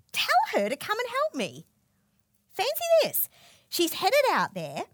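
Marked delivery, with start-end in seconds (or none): 0.1-1.6 high, pushy demand. Retold or imitated. Martha demands Jesus' help. 2.5-3.3 high, loud exclamation of disbelief; astonished. Real, not acted. Preacher's astonished aside.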